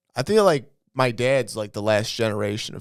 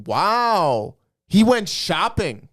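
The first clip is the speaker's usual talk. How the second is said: mock impressed family; 6 semitones higher, 4 dB louder, much slower than usual. Family quote, high slow.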